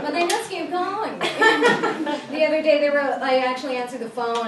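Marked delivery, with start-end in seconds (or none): none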